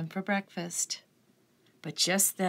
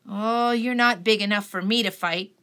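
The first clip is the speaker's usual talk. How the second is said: grouchy ladybug voice; 3 semitones higher, 6 dB louder than usual. A narrator's reading.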